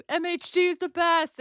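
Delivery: high, loud, enthusiastic booster; Eric impression. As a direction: cheer it out high and loud in someone else's voice. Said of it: "you always hear Eric, like".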